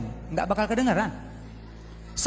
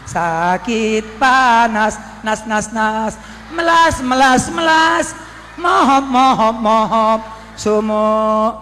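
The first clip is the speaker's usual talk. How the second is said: wailing sufferer; 3 semitones higher, 8 dB louder, much slower than usual. Acted. Damned screaming in hell.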